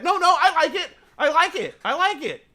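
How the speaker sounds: put-on whiny child begging for the juice; inner kid. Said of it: "Like the kid inside of you".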